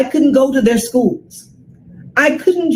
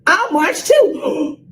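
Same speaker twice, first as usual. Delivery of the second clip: put-on eager little girl; 8 semitones higher, slower than usual. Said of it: "I said".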